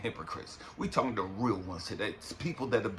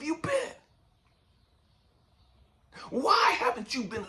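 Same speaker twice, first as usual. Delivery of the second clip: furious heartbroken cry at God; raging at God. Retold or imitated. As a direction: yell your grief at the sky. Retelling his rage at God.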